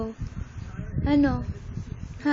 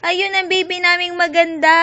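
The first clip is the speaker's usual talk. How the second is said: excited cheer; 4 semitones higher than usual. Real, not acted. Cheering the baby on camera.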